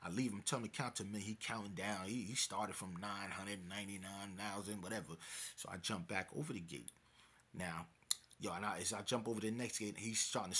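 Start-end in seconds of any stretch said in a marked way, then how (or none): none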